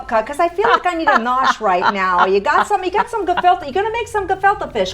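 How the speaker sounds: hint of a Yiddish-flavored Brooklyn accent, quick.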